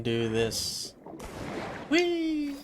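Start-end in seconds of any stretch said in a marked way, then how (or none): 1.9-2.6 high, bright playful squeal; gleeful whee. Real, not acted. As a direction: squeal with delight like on a slide. Playful cry while swimming.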